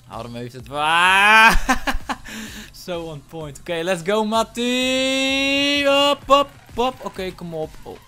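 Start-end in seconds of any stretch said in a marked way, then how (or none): 0.1-1.6 loud, slow, stretched-out call; drawn-out call. Real, not acted. Gamer reacting mid-match, long loud call. Singing out at game moment. 4.2-7.0 high, stretched-out rallying call. Real, not acted. Player rallying his teammate, high drawn-out call.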